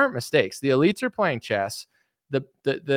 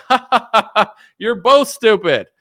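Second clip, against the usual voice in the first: mocking laughter; 8 semitones higher, 7 dB louder than usual. Bankers laughing down at voters, loud mocking cackle. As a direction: laugh down at both sides. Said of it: "the bankers look down at you and they're like".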